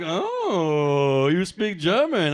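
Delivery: hammed-up pleasant surprise; mock impressed surprise. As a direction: play an impressed local, slowly. Germans acted reacting to his German.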